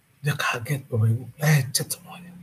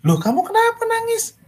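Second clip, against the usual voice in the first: high, startled and a bit louder questions; a father shocked to find his child crying; father startled to find his son crying at the door; worried father; 12 semitones higher, 3 dB louder than usual. Acted.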